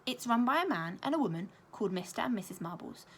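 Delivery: ordinary delivery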